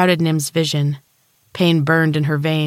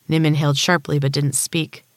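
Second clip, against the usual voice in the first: plain reading voice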